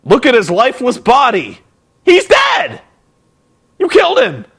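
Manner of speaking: high shocked commentator shout. Retold or imitated. Commentator announcing the body on air, shocked shout. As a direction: yell it like a stunned announcer. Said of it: "Mike Tenay, the commentator, is like".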